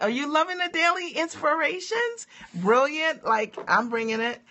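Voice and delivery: high-pitched, hyped-up question; excited. Real, not acted. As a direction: pump up the viewers, pitch way up. Hyped asking viewers about inspirations.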